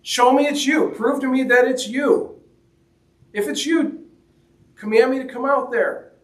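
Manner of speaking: high, pleading, halting Peter; voicing Peter. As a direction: beg for proof, stumbling over the words. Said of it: "he tells Jesus".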